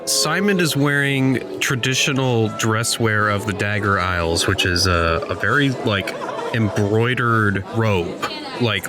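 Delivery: ordinary talk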